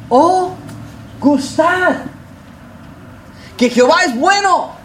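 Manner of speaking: lifted, solemn reading of the psalm; scripture recitation. Acted. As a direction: proclaim the verse with reverence. Quotes psalm in elevated voice. (recitando el salmo con solemnidad)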